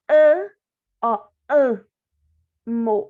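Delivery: "A, ó, a, mo" sounded out syllable by syllable, loud and stretched; syllables split apart. Shown as a demonstration. Teacher to pupils, splitting syllables loudly. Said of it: "O M tá tendo dois sons aí".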